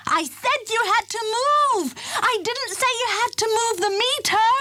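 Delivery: hop-mad, yell it after him; exasperated. A narrator's reading. Warden shouts after the elephant in frantic exasperation. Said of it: "jumping up and down".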